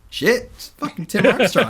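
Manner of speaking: high, stunned mutter of disbelief; starstruck shock. Retold or imitated. Merch guy recalling spotting [name], stunned high-pitched disbelief. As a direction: gasp it out like you just clocked a rock star. Starstruck inner thought on realizing.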